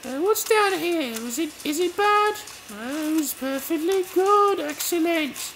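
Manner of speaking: high-pitched game heroine, chatty; lively high character voice; Faith voice. Acted.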